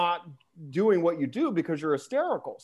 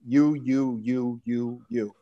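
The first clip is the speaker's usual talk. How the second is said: spelled ticker; 7 semitones lower, much slower than usual. Shown as a demonstration.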